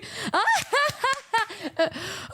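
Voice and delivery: high, drawn-out imitated cackle; long, high mock laugh that tails off; imitating mom's laugh. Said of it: "her laugh is so distinct".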